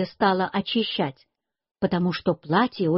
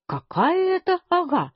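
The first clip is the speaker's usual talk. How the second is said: higher-pitched puzzled question; narrated grandmother asking, pitched up; 9 semitones higher than usual. A narrator's reading. Grandmother asks the boy who Ага is, puzzled. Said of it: "Бабушка говорит".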